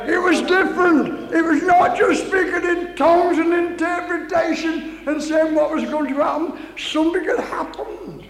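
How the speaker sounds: high, loud testimony; full-force preaching. Real, not acted. Preacher tells congregation of Pentecostal blessing, full-force preaching. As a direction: drive the testimony home to the room.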